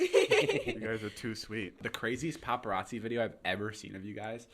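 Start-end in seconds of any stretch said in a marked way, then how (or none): 0.0-0.8 laughing. Real, not acted. Laughing reply to friends.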